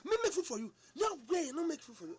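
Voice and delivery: high, loud, outraged shout; mock angry shout. Wife shouting at husband who asks for food, outraged. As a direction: yell the refusal back at him.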